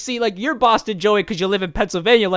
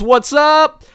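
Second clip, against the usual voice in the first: mock hype greeting; 9 semitones higher, 9 dB louder than usual. Reading out a chat greeting.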